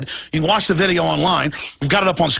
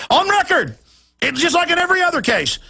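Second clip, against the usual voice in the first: indignant outburst; 10 semitones higher than usual. Real, not acted. Outburst after laugh.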